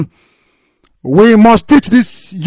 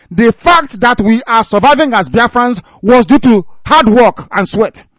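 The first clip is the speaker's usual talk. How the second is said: angry rant; 6 semitones higher, 5 dB louder than usual. Real, not acted. Ranting about leaders and poverty.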